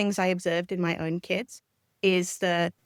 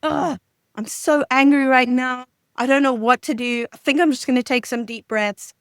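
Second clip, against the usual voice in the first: narrated frustration; 5 semitones higher, 6 dB louder than usual. Shown as a demonstration. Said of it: "to narrate".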